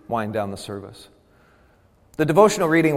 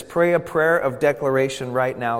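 ordinary delivery

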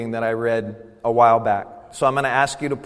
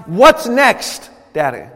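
put-on high, eager child's question, dropping on the last word; childlike; 10 semitones higher, 8 dB louder, slower than usual. Believer greets God as Daddy, childlike and expectant. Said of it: "greeting God with a childlike".

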